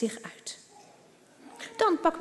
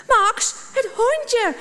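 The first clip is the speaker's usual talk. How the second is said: high, loud and slow, on the edge of tears; girl calling her brother as the puppy runs off, tearful; frightened cry; 11 semitones higher, 7 dB louder, slower than usual. A narrator's reading. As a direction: cry out in panic for the lost dog. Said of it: "roept ze verschrikt".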